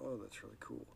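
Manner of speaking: flat, soft brush-off "Oh, that's really cool"; bland reply. Retold or imitated. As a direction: kid shrugs off a parent's excitement, soft and bland. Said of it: "They look at it and go".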